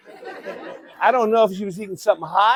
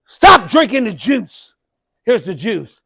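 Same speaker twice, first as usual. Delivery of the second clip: ordinary talk, nothing marked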